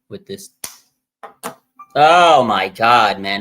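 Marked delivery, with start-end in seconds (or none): 2.0-3.4 drawn-out, loud frustrated outburst; loud exasperated groan. Real, not acted. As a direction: let it burst out slowly, fed up. Fuming over team injuries.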